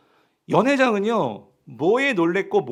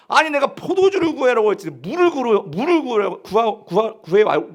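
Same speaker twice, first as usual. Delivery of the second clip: raised, louder voice, tripping over the words; angry scolding; 4 semitones higher, 5 dB louder than usual. Acted. Banquet master berating the servant over water instead of wine, angrily. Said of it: "어떻게 화를 내지 않겠어요".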